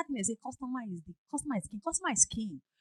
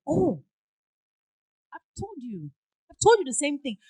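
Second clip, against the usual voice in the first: loud, exasperated complaint; irritated; 4 semitones higher, 8 dB louder than usual. Retold or imitated. Caller repeating complaint loudly.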